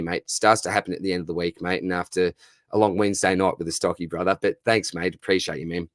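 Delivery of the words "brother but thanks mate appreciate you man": usual speaking voice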